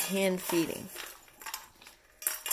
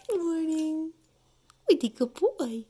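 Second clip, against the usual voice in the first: dog talk; 8 semitones higher, 4 dB louder than usual. Real, not acted. Greeting the dog.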